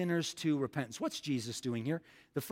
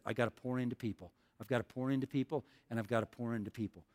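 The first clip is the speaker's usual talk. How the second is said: quiet determined vow; 5 semitones lower, 5 dB quieter, faster than usual. Retold or imitated. Voicing Jesus' resolve.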